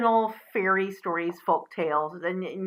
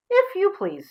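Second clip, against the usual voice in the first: much higher pig voice; high pig politeness; polite little pig; 12 semitones higher than usual. Acted. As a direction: ask sweetly as a tiny pig.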